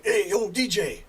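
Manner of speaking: drawn-out retold shout-out; calling out. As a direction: hail someone from the back of the bus. Prisoner calls from back of bus.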